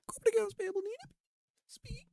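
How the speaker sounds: very high falsetto office receptionist; mock switchboard operator asking caller to hold. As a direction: put on a squeaky secretary voice. Mock receptionist before stepping away.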